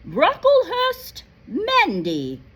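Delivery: loud roll call; calling out a name. Acted. McGonagall calls the next student up, loudly. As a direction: call the name across the hall.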